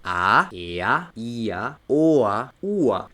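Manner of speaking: each vowel gliding into an open a; vowel pairs drilled one at a time; R como A. Shown as a demonstration. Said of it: "la R va a pasar a sonar directamente". (vocales seguidas de una a, como ejercicio)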